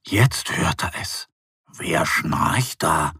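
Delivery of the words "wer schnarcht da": low, very slow bear voice; wondering bear. Acted. Bear Mo wonders who is snoring, low and slow. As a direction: drag it out, puzzled. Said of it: "wundert sich Mo".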